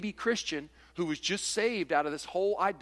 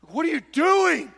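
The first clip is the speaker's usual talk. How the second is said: high, loud shocked yell; mock outraged shout; 12 semitones higher, 9 dB louder, slower than usual. Preacher mimics a legalist shouting at a meat-eater. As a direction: shriek it in hammed-up outrage. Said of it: "they're over here going".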